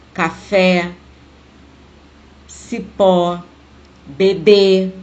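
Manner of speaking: "café, cipó, bebê", each final syllable stressed; stress on last syllable. Shown as a demonstration. Teacher giving oxytone examples, "café, cipó, bebê" stressed on the last syllable. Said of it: "A sílaba tônica é a última".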